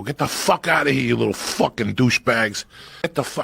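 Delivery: sudden mock outburst at loitering kids; acted angry yell; retold angry shout. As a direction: imitate a furious neighbor chasing kids off. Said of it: "I understood the anger".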